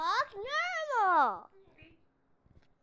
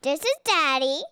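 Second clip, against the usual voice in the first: crazy voice; 4 semitones lower, 6 dB louder than usual. Acted. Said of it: "You have a crazy voice".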